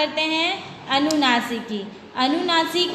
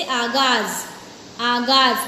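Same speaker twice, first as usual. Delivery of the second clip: "आगाज" with the weight on गा; stress on middle syllable; 4 semitones lower, much slower than usual. Shown as a demonstration. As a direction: lean on the long middle vowel in "आगाज". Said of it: "बलाघात जो होगा उस बीच वाले अक्षर पर".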